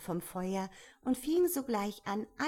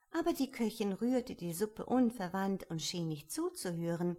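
ordinary delivery

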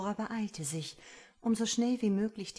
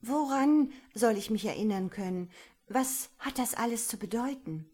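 aufgeregt verstört; 4 semitones higher, 5 dB louder than usual. Acted. Aurora fragt verstört weiter.

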